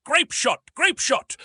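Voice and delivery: high, loud gunnery command; shouted order. A narrator's reading. Gun captains ordering cannoneers to reload, high loud shout. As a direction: shout it twice down the gun line. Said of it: "gun captains were shouting".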